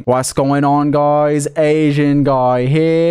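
loud, low British streamer intro; Atsu. Acted. Chat guesses whose stream they're joining, British intro impression. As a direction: drawl out a British stream greeting. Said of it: "because it's his intro".

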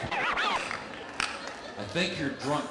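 drawn-out accusing. Acted. Mock confrontation with puppet.

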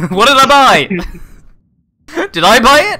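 shrill laughing disbelief; high excited yell. Real, not acted.

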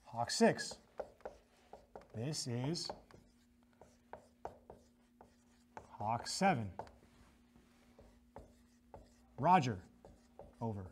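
spaced, formal radio reply; radio operator reply. Shown as a demonstration. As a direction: answer like on the net. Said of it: "he will say back".

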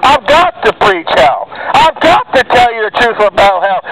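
usual speaking voice

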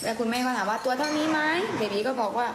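ordinary talk, nothing marked

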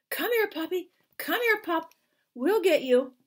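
high coaxing call to a puppy. Acted. Crewman calling to the puppy in the water, coaxing. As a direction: lure the puppy in, sweet and high.

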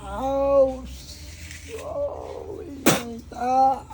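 high-pitched cries of pain; pained cry. Real, not acted. Patient feels heat during healing, crying out in pain. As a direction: cry out as if burned.

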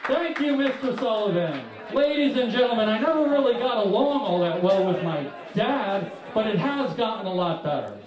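normal talking voice

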